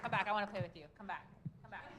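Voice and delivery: loud playful call to come back. Acted. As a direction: shout after them to come back. Calling someone back loudly.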